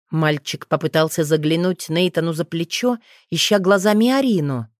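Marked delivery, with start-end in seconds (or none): none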